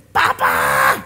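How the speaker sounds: short loud call for papa; child calling out. Retold or imitated. Child yelling for papa from another room.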